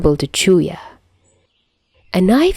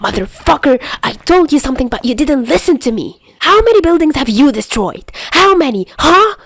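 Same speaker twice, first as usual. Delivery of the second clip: angry yelling; 7 semitones higher than usual. Acted. Albatross yelling angrily at the fleeing bomber. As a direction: tear into him as he runs off. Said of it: "yells at the person".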